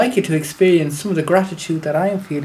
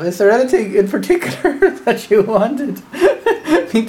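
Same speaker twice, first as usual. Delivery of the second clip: talking through laughter; chuckling mid-sentence; laughing; 5 semitones higher, 4 dB louder than usual. Real, not acted. Master amused by disciple.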